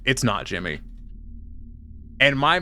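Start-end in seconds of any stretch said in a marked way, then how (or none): 0.1-0.8 deadpan. Retold or imitated. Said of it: "look him dead in the eye and go".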